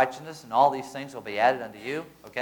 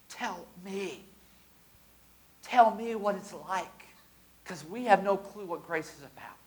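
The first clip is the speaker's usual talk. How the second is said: lightly voiced eager angels, slow; curious angels; 3 semitones higher, slower than usual. Angels asking believers about grace, lightly voiced eager request. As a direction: angels begging to hear. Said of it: "But then they'll say".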